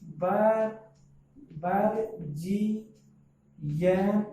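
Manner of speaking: बर्जियन broken into drawn-out syllables with gaps. Shown as a demonstration. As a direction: count out the syllables of बर्जियन very slowly. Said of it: "इसमें कितने syllable में".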